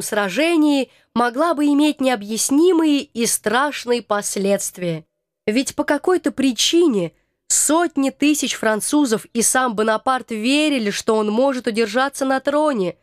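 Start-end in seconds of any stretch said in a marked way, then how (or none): none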